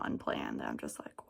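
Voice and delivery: hushed unvoiced whisper. Real, not acted. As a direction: breathe it out under your breath. Frustrated aside, unvoiced.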